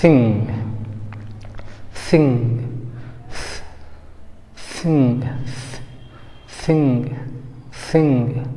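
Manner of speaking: थिंग with a hissed soft th; थिंग opening on an airy th, not a stop; native th sound. Shown as a demonstration. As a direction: say थिंग with tongue between teeth, air flowing out. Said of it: "वो लोग बोलते हैं".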